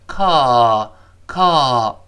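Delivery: "靠" as English "call" with a long open ah; sigh-like vowel. Shown as a demonstration. Teacher shows the relaxed American vowel, "靠" as long "kah". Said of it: "李老师管它叫叹气音".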